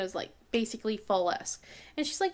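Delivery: ordinary delivery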